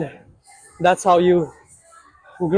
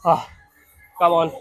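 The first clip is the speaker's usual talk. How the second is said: counting reps under strain; effortful gym grunting; straining through reps. Real, not acted. Counting reps under heavy leg press.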